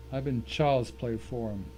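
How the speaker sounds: ordinary talk, nothing marked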